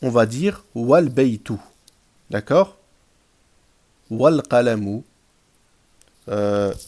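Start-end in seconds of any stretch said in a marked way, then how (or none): 0.8-1.5 "wal baytu" linked, no a before the l; silent alif. Shown as a demonstration. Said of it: "on ne le prononce pas". 4.1-5.0 "Wal qalamu" joined, the alif silent; loud, clear "Wal qalamu", wa running into the l; liaison. Shown as a demonstration. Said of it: "on ne le prononce pas".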